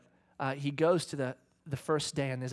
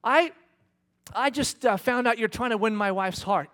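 retold confrontation; 7 semitones higher, 8 dB louder than usual. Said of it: "he goes".

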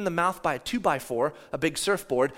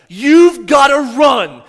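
loud, slow, raised-pitch command; angry shout; 8 semitones higher, 18 dB louder, much slower than usual. Retold or imitated. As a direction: yell it furiously at your child, drawing it out. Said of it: "I'm mad at the entire situation".

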